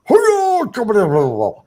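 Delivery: mock Japanese barking, starts very high and drops; barking sushi chef. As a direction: imitate an irate chef barking gibberish. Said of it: "this dude is barking".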